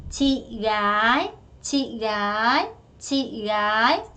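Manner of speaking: chị gái said very slowly, gái rising; drawn-out chị gái repeated; slow drill. Shown as a demonstration. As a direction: stretch every syllable of chị gái. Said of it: "drilling vocabulary aloud".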